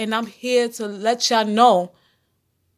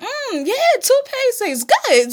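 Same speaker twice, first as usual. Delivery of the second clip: put-on high, slow, savoring child voice; delighted kid; 12 semitones higher, slower than usual. Host voicing a kid who likes toothpaste, high and slow. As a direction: play a kid smacking lips over sweet toothpaste.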